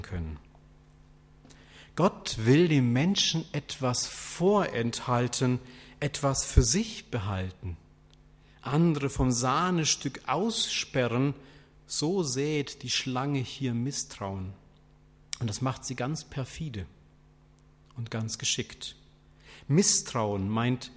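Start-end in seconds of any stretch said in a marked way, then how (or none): none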